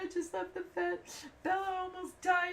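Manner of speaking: high-pitched, tearful retelling; mock bawling. Phoning Todd after leaving the vet, mock bawling. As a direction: imitate wailing down the phone through tears.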